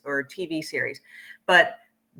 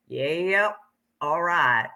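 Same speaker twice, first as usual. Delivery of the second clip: picked-up Southern drawl; much slower than usual. Retold or imitated. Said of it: "And I'm like".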